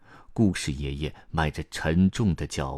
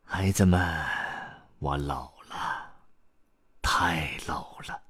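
put-on slow old man fading away; slow, softening lament; weary confession; slower than usual. A narrator's reading. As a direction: let the words sink into a breathy near-whisper. Weary grandpa confesses his age.

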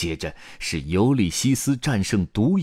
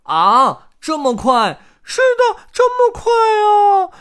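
very high, loud little-chick squeals; protesting chicks; 11 dB louder, slower than usual. A narrator's reading. Chicks protest that story time is over, squealing in dismay. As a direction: wail in protest like disappointed chicks. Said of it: "小鸡们抗议道".

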